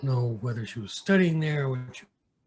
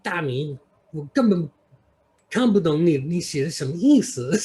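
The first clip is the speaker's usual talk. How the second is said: raised, louder, puzzled friend's voice; imitating the friend; 4 semitones higher, 6 dB louder than usual. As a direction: mimic her baffled reply, a bit high. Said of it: "she's like".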